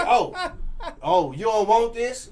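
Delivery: high, loud mock squeal of an offended woman. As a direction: squeal it high like a woman who can't believe she's turned down. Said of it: "And then she like".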